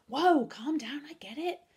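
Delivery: high, drawn-out "whoa"; startled placating. A narrator's reading. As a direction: back off loudly, calming someone down. Girl calming a shouting friend.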